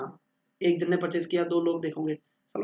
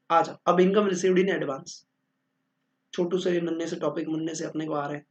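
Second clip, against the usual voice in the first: ordinary talk, nothing marked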